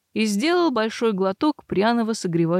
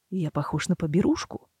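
cold; 4 semitones lower, 4 dB quieter than usual. A narrator's reading. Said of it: "холодно поинтересовался охотник".

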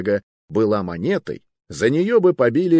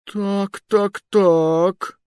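high, stretched suspicious drawl; drawn-out "so, so"; 12 semitones higher, 4 dB louder, much slower than usual. Acted. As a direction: draw it out, narrowing your eyes. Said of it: "протянул король".

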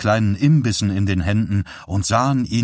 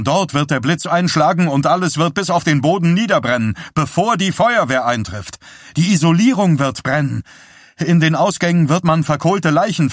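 urgent prophecy climbing steadily higher; clear, carrying warning; 7 semitones higher, 3 dB louder than usual. A narrator's reading. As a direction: build from raised voice to high, frantic urgency. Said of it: "Johnnys Stimme klang klar und mäßig laut". (eindringliche Warnung, immer höher und drängender)

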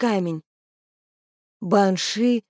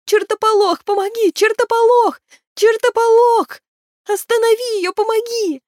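very high, urgent cries; desperate call for help; 14 semitones higher, 4 dB louder than usual. A narrator's reading. Maeve trapped in bushes summoning help, shrieked plea. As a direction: call out high and pleading, again and again.

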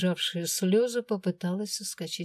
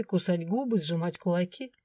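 plain reading voice

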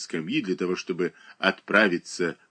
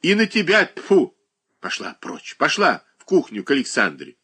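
irritated shout; 6 semitones higher, 4 dB louder than usual. A narrator's reading. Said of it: "раздражительно кричала Шершнёва".

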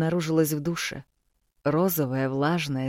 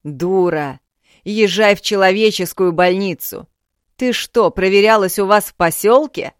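angry yelling; 5 semitones higher, 11 dB louder than usual. Acted. Father yells at daughter.

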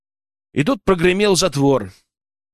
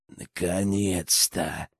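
low, slow, soft repulsive rasp; vile rasp; 9 semitones lower, 9 dB quieter, much slower than usual. A narrator's reading. Poacher creeping into the pit to his captives, nasty rasp. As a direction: wheeze it out like a nasty villain. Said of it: "прохрипел он мерзким голосом".

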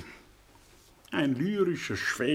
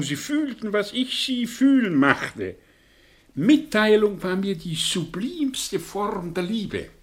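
swept-up fervour; 6 semitones higher, 4 dB louder than usual. Acted. Recalling his youthful power, swept away.

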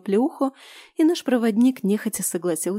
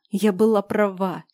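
usual speaking voice

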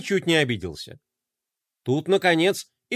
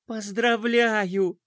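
slow congratulation; 4 semitones higher, much slower than usual. Acted. Princess congratulating the winner.

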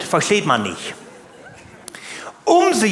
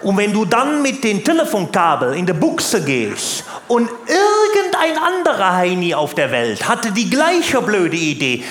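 ordinary talk

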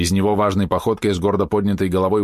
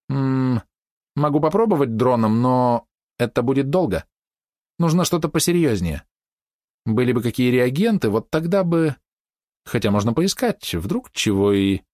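higher, hesitant thinking aloud; lightly voiced fox girl, pondering; 5 semitones higher than usual. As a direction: think it through aloud, trailing off unfinished. (слегка озвученная лисичка, задумчиво)

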